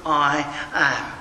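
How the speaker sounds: slow, mock self-importance; lightly imitated pompous big shot; Naaman. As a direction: measure out each word like an affronted commander. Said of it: "This is Naaman".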